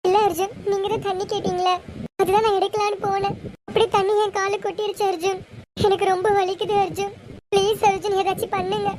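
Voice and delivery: wife begging her husband for help, in pain; pained pleading. Acted. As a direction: plead through the sting of a burn.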